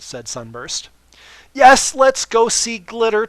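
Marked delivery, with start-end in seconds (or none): 1.5-3.3 loud, high and slow gush; hammed-up overeager yes; far too enthusiastic. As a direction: overdo the excitement. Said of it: "far too enthusiastically".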